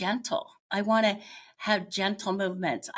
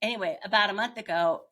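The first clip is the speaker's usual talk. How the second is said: usual speaking voice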